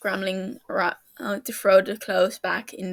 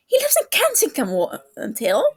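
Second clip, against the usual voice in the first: high, fast, louder yelp; 5 semitones higher, 4 dB louder than usual. A narrator's reading. James yelping in alarm at his friend on the phone. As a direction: blurt it out in a startled squeal. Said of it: "James yelps".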